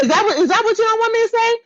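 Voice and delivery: ordinary talk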